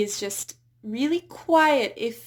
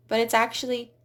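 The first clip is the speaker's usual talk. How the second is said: usual speaking voice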